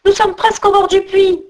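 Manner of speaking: high-pitched, urgent plea; urgent protest. A narrator's reading. Jean de l'Ours urges the tiring eagle on, high urgent plea.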